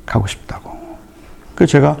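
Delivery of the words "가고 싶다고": whisper. Retold or imitated. Son nods carefully.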